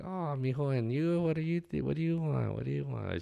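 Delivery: high, put-on restaurant owner's voice; Tola. Owner greets one brother, questions the other, high impression. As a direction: imitate the owner pitched high, from fond greeting to curt question. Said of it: "y'all know the Tola voice".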